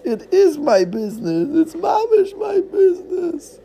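put-on high, loud Yiddish-accented wailing. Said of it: "Yiddish-flavored distraught owner".

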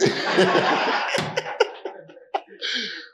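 a real, full laugh at his own list; hearty laugh. Real, not acted. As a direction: laugh out loud with the congregation. Laughing with the congregation.

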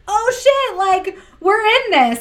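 high, slow, stunned; shocked realization. Retold or imitated. After his love text, a high stunned realization. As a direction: let it dawn on you, high and slow. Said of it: "And then I was like".

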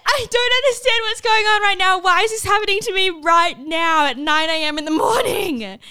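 high, loud, hammed-up wail of disbelief; mock meltdown. Learner refused her test at nine AM, mock meltdown. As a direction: wail it like the world is against you. Acts out outburst at being refused.

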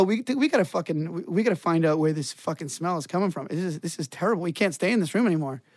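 low, rushed, hushed; hushed urgent quote. Retold or imitated. To his roommate about the smell, a hushed rushed mutter. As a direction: mutter it fast under your breath, disgusted.

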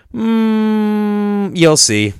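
long high hum, then a punchy payoff; imitated knowing tease. Friend hinting at what chickens are for, teasing. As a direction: hum teasingly before dropping the hint.

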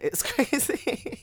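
high, drawn-out laughing squeal; giddy laughing. Real, not acted. Guest marvels at living with partner, giddy laughing. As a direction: squeal it slowly through a laugh.

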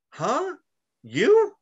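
high-pitched, acted double-take; incredulous. Son hears his parents went to a motorcycle rally, incredulous. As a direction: squeak each question in mock disbelief. Retold shock at parents' biker outing.

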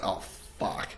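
whispered sinking realisation; hushed "oh no" to yourself; dismayed aside. Retold or imitated. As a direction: whisper it as a retold private panic. Retelling his dismayed inner reaction.

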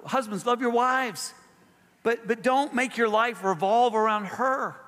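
high, emphatic exhortation; preaching at full force. Acted. Pastor exhorting parents and spouses, preaching high and urgent. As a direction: press each command on the listeners.

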